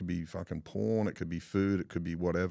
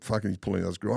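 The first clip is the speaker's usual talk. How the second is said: usual speaking voice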